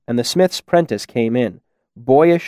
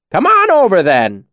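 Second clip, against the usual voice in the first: high, loud hail that drops at the end; calling over; 8 semitones higher, 5 dB louder than usual. A narrator's reading. Cobb invites the scribe to the bar, calling out. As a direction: call the newcomer over to join in. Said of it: "Cobb called to him".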